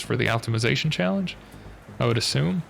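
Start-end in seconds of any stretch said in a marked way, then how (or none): none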